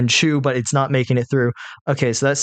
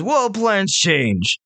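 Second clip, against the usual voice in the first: hobgoblin; 6 semitones higher, much slower than usual. Acted. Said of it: "He says".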